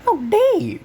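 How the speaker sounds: shrieked high and loud, full of outrage; a sudden indignant yell; mock outraged shriek; outraged cry. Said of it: "and he goes like".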